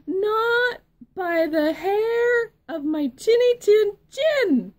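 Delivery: high, loud squeaky pig voice; little pig voice. Acted. Little pig defiantly refusing the wolf, high and loud.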